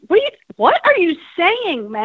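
retold "are you serious" reaction, stretched out; incredulous. Acted quote of incredulous reply.

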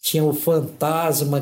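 ordinary delivery

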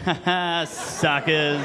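snickering laugh, then drawn-out taunt; sly mock triumph; gloating. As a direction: gloat as the danger passes you by. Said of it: "you just go".

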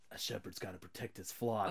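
low, hushed movie line; close to the mic. Retold or imitated. Podcaster quoting the actor's cringey line, low and close. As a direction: drop it low and soft, right up close. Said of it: "I'm gonna get close".

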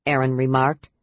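usual speaking voice

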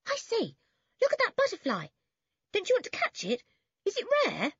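put-on very high, eager boy's voice; breakneck-keen, high-pitched questions; excited cry. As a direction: exclaim at a sudden find. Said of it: "Then he gave a cry".

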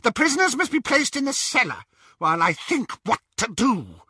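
high, cold witch order. A narrator's reading. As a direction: command the sister. Bellatrix voiced.

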